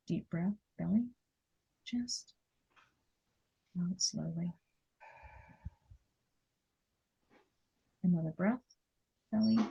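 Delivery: quiet breathing coach; guided breathing. Acted. Guiding breathing, softer.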